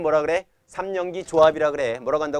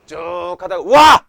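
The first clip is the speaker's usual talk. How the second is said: slow build, then a much louder 와 burst; sudden shout; 13 dB louder, much slower than usual. Shown as a demonstration. Teacher to class, acting out the stop codon with a sudden shout. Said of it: "이러면 끝내는 거야".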